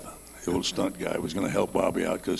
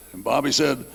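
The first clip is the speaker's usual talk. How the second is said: usual speaking voice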